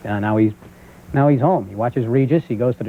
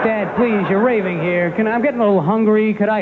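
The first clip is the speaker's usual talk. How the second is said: put-on pleading kid; 7 semitones higher than usual. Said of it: "I go".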